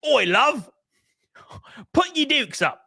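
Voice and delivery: put-on high-pitched British "European" accent; mock European accent. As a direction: do a cartoonish British audition voice. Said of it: "must have a European accent".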